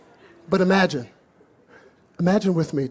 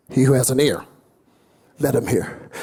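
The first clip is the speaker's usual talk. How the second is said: solemn Jesus quote; 5 semitones lower than usual. Acted. Quoting Jesus' closing line.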